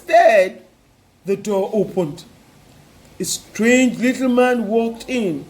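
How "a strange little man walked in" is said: plain reading voice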